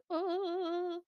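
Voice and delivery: high, drawn-out "ohhh"; mimicked astonished "oh". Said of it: "have, like, that O look".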